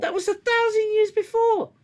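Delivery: high and loud, THOUSAND years pushed up in outrage; outraged repeat. Real, not acted. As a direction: yell it in disbelief.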